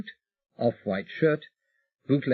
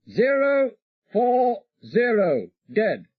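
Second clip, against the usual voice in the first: higher, slow, clipped radio readout; pilot reading course; 12 semitones higher, 4 dB louder, slower than usual. Acted. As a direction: spell the heading out slowly.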